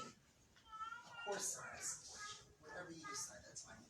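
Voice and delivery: husband gently comforting his wife; tender reassurance. Retold or imitated. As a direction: soothe her tenderly. Husband soothing hurting wife.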